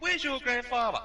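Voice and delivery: high mock-angry dad. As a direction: bark it like the dad wanting answers. Dad demanding where Pop is.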